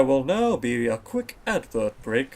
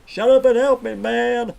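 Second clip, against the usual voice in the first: high, loud furious yell; stuck otter yelling at the seal; angry yell; 6 semitones higher, 4 dB louder than usual. Acted. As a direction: yell up in desperation.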